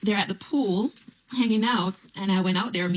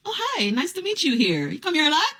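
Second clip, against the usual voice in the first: fake polite greeting; 9 semitones higher than usual.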